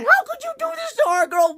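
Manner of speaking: very high, shrieking accusation; mock betrayed yell. Reviewer accusing Caitlyn of hurting their girl, very high shriek. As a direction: shriek the question at her in outrage. Scolding the character on screen.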